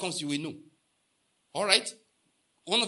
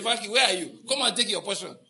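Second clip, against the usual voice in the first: loud, higher-pitched eager summons; acted calling out; 4 semitones higher, 6 dB louder than usual. Preacher acts out calling a friend to share money, calling out. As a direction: call out to a friend across the way.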